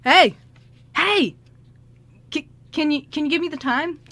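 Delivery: high, loud hail to a stranger; calling out. Acted. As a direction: call after someone walking away, then ask hopefully. Character calls after a passing stranger.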